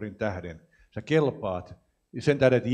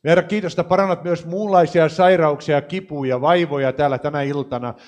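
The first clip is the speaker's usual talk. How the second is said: higher and louder, preaching at full force; full-force healing prayer; 3 semitones higher, 7 dB louder than usual. Acted. Preacher calls on God to heal the congregation, loud fervent preaching. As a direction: cry out for healing over the crowd, pressing each phrase.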